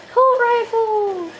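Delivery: high, slow singsong call; drawn-out high call. Pretend. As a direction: stretch it out high, hailing the weapon. Hails a weapon found in game.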